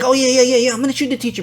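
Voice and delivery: high, over-eager mock agreement; exaggerated yes-yes reassurance; placating brush-off. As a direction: fob them off with rapid high yeahs. Acts out fobbing her off.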